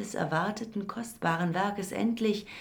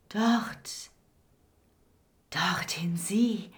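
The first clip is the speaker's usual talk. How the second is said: panting, hoarse whisper; much slower than usual. Acted. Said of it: "keuchte er mit heiserem Flüstern".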